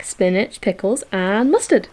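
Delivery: normal talking voice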